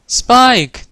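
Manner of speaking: loud, high call of the name. A narrator's reading. Twilight calls to her dragon companion, calling out. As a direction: holler for your companion. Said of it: "Twilight called".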